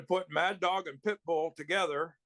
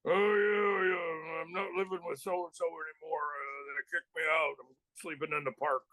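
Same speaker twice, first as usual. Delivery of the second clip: [name]. Retold or imitated. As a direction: imitate a buddy mumbling his hard-luck excuse. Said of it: "That's exactly the way he talked".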